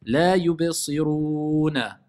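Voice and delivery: slow chanted yubsiruna with an added A; faulty stop on yubsiruna; voiced final vowel. Shown as a demonstration. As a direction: hold "rou", then release a wrong "na" in yubsiruna. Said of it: "On va pas dire".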